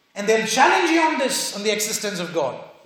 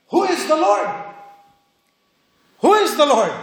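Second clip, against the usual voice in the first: defiant; 4 semitones higher, 4 dB louder than usual. Acted. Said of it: "Look at the audacity".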